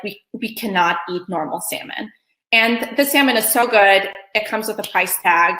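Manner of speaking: normal talking voice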